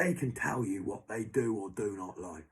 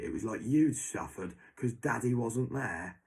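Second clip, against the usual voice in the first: usual speaking voice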